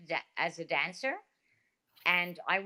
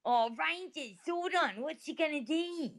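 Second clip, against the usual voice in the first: high, softer mock Australian worry; hammed-up Australian robot fretting; Australian accent; 8 semitones higher, 5 dB quieter than usual. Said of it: "That would be Australian".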